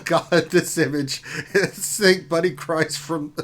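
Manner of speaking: slowed, broken up by laughter; laughing. Real, not acted. Streamer reacting to a viewer's image, laughing. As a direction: try to read through the giggles.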